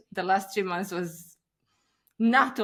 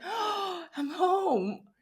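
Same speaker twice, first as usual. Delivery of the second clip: relieved sigh; 11 semitones higher, much slower than usual. Retold or imitated. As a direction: sigh out I'm home like coming back at last.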